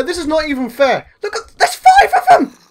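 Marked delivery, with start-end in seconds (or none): 1.2-2.6 high, loud laughing yelp of alarm; panicked laugh. Real, not acted. Swarmed by zombies in game.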